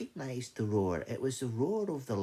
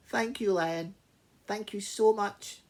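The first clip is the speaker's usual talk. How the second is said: high-pitched, gushing little mouse; grateful mouse; 10 semitones higher, 6 dB louder than usual. Acted. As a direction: pipe your thanks up high. Mouse thanks the lion.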